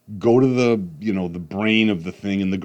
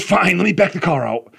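higher, louder grudging retort; irritated yell; 10 semitones higher, 7 dB louder than usual. Retold or imitated. Dad giving in to son's point, loud and annoyed. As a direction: bark it, still annoyed. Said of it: "So I'm yelling at him".

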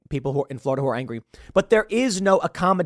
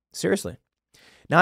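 normal talking voice

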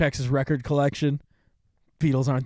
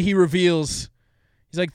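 ordinary delivery

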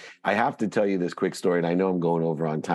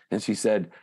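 usual speaking voice

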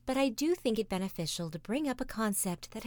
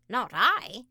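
loud, higher quack of a duck; noisy duck quacking; 4 semitones higher, 6 dB louder than usual. Acted. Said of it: "quacked the noisy yellow duck".

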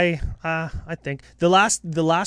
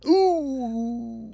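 mock surprise; 9 semitones higher than usual. Real, not acted. Reacting to ranking.

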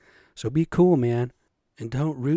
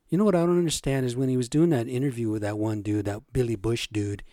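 usual speaking voice

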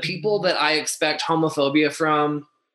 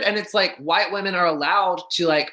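ordinary delivery

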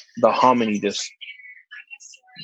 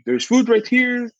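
uncle offering food; 12 semitones higher than usual. Retold or imitated. Uncle urges the stuffed guest to eat, high coaxing voice. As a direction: push the food on him like a doting uncle. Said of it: "He's like".

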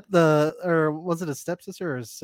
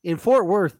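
usual speaking voice

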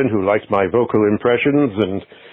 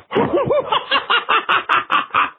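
shrill maniacal cackle, much higher; mad laugh. Acted. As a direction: cackle like a madman unmasked. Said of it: "here's my impression of the Invisible Man".

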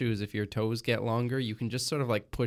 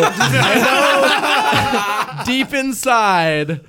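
laughing; 9 semitones higher, 11 dB louder, much slower than usual. Real, not acted. Laughing at own mistake.

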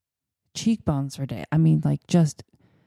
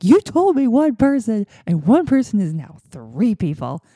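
higher, louder mock scolding gate agent; a lightly voiced indignant gate agent; hammed-up scolding official; imagined scolding agent; 5 semitones higher, 5 dB louder than usual. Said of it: "this woman isn't like".

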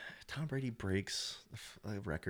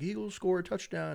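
announcer call; 11 semitones higher, 3 dB louder than usual. Retold or imitated. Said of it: "Al Michaels is like".

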